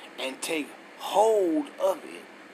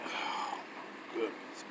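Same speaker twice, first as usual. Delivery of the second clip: whispered dismay; 5 semitones lower, 12 dB quieter, slower than usual. Real, not acted. Exasperated aside while searching.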